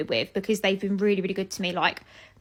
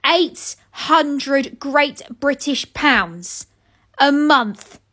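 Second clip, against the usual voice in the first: each word slowly hammered out, rising to loud; slow stunned emphasis, getting louder; slow outraged emphasis; 5 semitones higher, 5 dB louder, much slower than usual. Real, not acted. Shocked quoted price.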